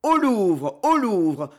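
a touch of fury in the rallying cry; furious mob cry. A narrator's reading. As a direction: echo the call as a rioting crowd. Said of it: "répéta la voix furieuse et grondante de l'émeute".

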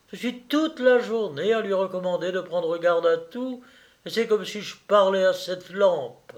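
high, indignant litany of complaints; exasperated scolding cousin. Acted. Sylvie listing her cousin's faults before guests, exasperated scolding.

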